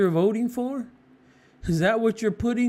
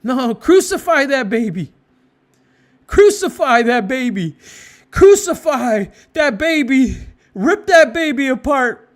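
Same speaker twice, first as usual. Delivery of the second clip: hammered furious chant, louder on each crucify; mock angry mob; 6 dB louder than usual. Preacher voicing the mad crowd, furious louder chanting. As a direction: rage like a baying mob demanding it. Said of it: "they get all more mad".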